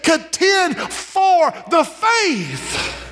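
drawn-out high preaching cry, stretching "for"; slow preached shout. Acted. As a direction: cry it out slowly to the congregation.

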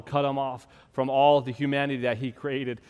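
usual speaking voice

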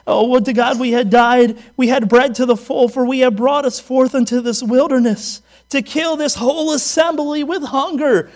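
loud, whiny mock lament; mock complaining Israelites. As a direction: ham up their moaning. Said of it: "they go".

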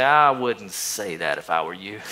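low, slow, gentle warning; Holy Spirit's voice. Acted. Holy Spirit warning a tempted poster, low and slow. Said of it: "the Holy Spirit would say".

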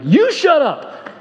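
high, slow yelled retort; a mild mock shout from the neighbours. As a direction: bark it back from the window.

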